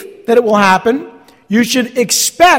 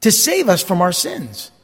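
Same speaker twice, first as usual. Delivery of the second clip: normal talking voice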